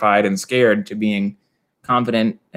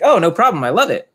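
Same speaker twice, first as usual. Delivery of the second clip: breezy confidence; 7 semitones higher, 4 dB louder than usual. Retold or imitated. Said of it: "They're like".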